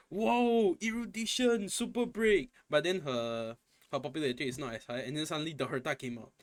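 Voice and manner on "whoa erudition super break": higher pitched, imitating fans' awe; hammed-up fan wonder; mock hype. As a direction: parody the crowd gushing over a new unit. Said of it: "everyone is like".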